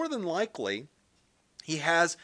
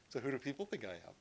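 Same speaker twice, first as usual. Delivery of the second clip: quick, softer, fishing for approval; a hint of insecure asking, rushed and hushed; needy mock Jesus; 4 semitones lower, 11 dB quieter, faster than usual. Pastor mocks an approval-seeking Jesus, quick and hushed. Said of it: "He's not going like".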